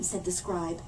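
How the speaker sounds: plain reading voice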